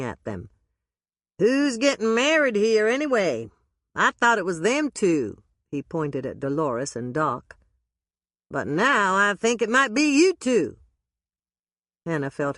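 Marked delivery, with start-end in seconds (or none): 1.4-5.4 loud, high hammed-up Southern accent; brash bad Southern accent greeting. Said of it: "a voice with a really bad Southern accent". 8.5-10.8 loud, high hammed-up Southern accent; brash bad Southern accent greeting. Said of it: "a voice with a really bad Southern accent".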